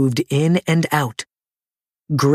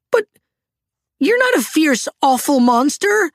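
very high little character voice; blurting out; 13 semitones higher than usual. A narrator's reading. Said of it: "Little Toot blurted out".

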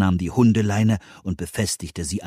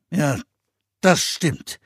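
grimmig; 6 semitones higher, slower than usual. A narrator's reading. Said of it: "sagte Meister Eder grimmig".

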